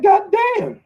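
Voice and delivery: high-pitched exclamation of disbelief; high, drawn-out incredulous reaction; mock astonishment. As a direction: squeal it high, as if taken aback. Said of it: "I'm like".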